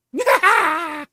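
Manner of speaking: high, very loud mad cackle; shrieking evil laugh; villain cackle. Acted. Caller laughs before the line goes dead, loud mad cackle.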